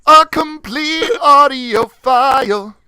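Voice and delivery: high, loud, drawn-out proclamation; mock grand echo. Echoing the cohost about sending the recording, mock grand. Mockingly echoes the other speaker's phrase.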